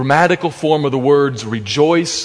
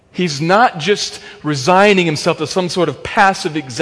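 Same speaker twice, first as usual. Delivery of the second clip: normal talking voice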